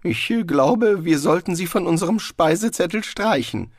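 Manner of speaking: high, uncertain suggestion; unsicher. A narrator's reading. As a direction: advise against it, unsure of himself. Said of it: "brummte unsicher". (unsicher, mit hoher Stimme)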